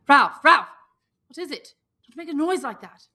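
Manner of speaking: high, loud urgent calling of a name; startled, waking him in fright; frightened boy shaking his friend awake; alarmed call. Acted. Piggy waking Ralph from nightmare.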